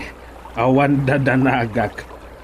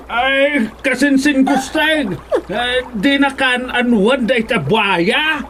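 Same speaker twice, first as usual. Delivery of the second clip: higher, urgent worried calls; alarmed cry; 10 semitones higher than usual. Acted. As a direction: call out to the cousin in fright. Cousin cries out in alarm.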